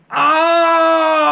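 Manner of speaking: high sharp cry; yelp of pain. Acted. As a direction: yelp like a stung cat. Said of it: "cried the fat cat".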